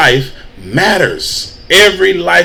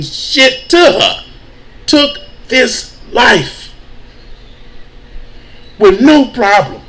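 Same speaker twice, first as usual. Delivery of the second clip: much higher and slow, voice straining; slow, high and strained with emotion; "took" falls off hard, pitch way up in disbelief; emotional; 8 semitones higher, slower than usual. Real, not acted. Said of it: "these type of things get me kinda emotional".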